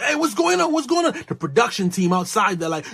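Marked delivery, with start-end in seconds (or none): 0.0-1.1 high, urgent calling out; mock alarm. Camera crew reacting to a crash, mock alarmed. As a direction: imitate a startled crew checking in.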